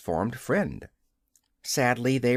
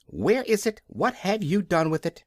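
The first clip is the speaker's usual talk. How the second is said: high, urgent questioning; alarmed demand; 6 semitones higher than usual. A narrator's reading. The Steward presses the King about his belt, high and urgent. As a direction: press him, alarmed and quick.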